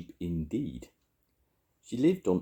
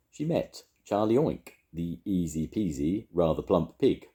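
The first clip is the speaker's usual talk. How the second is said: ordinary delivery, nothing marked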